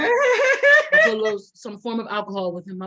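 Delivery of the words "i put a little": laughing through the words. Real, not acted. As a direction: giggle as you say it. Laughs at her secret.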